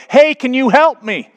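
high, loud call for help; acted yell. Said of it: "the man in the hole yelled out".

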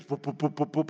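low repeated boop of rotor blades; imitated helicopter noise. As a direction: mimic a helicopter's thudding rotor. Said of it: "I hear this".

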